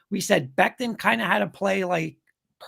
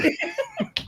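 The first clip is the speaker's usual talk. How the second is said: very high-pitched, softer laugh; real laugh; 6 dB quieter than usual. Real, not acted. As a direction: burst into high laughter at the joke. Amused by joke about Dave's age.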